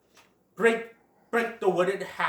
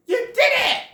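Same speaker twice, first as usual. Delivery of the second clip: high, loud cheer; Flower; 9 dB louder than usual. Acted. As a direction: celebrate a friend's success with a squeal. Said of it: "Flower's all like".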